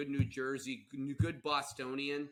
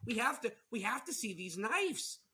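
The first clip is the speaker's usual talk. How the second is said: high-pitched, eager Midwest housewife act; hammed-up Midwestern enthusiasm; Midwest accent; 8 semitones higher than usual. Spouse welcomes a traveling knife salesman, hammed-up Midwest accent. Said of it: "It's the best accent".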